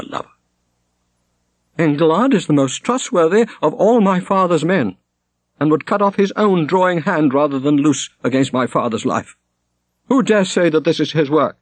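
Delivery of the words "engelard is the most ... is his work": put-on high, fierce young woman's challenge; a grieving girl turned fierce; defiant daughter. A narrator's reading. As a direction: defend her man against the whole circle. Shonet defends her lover.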